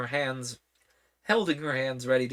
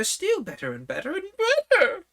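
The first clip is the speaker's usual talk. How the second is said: climbing to a squeak; 6 dB louder than usual. A narrator's reading. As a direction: pitch rising higher on each better. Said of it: "her voice went higher with each better".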